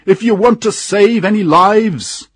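high, loud urgent yell; shouting from a boat. A narrator's reading. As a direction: holler it across the water to the rowers. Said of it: "shouted a voice from the boat".

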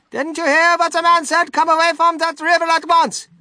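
high, loud motherly scolding; shrieky mother ordering her son back; scolding mother's call. A narrator's reading. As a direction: call out a telling-off at high pitch. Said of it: "called out Missus Gloop".

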